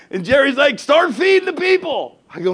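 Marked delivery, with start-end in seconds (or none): 0.8-2.2 high, raised urging; urgent exhortation. Retold or imitated. Jerry urging the pastor to feed people, loudly excited. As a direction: imitate an excited order, pitched way up. Jerry urges him to hand out food.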